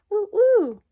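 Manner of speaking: high, loud barking woo-woo; dog bark. A narrator's reading. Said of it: "who barked".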